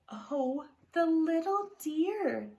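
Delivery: high, slow, tender coo. Acted. Wife cooing over a found piglet, tender.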